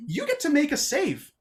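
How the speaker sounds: ordinary talk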